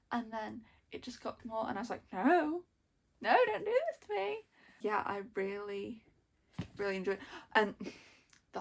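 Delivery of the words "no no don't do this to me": high, louder mock-wailed plea; pleading protest. Reader begging the book about Elliot, high pleading protest. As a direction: plead in rising, hammed-up dismay. Acted protest at the plot twist.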